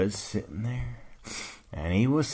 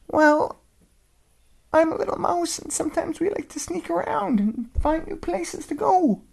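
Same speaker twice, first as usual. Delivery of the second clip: high, tiny mouse voice; little voice; 3 dB louder than usual. Acted. Mouse answering the old dog, tiny and high. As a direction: squeak it out small.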